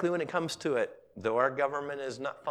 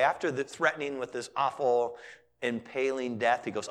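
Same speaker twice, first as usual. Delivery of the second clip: ordinary delivery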